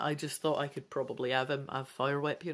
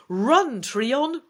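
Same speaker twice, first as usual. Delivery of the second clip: loud, higher shout; 8 semitones higher, 9 dB louder than usual. A narrator's reading. Rhinath warns Trion of the dragon, shouting. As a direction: yell a warning. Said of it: "Rhinath shouts".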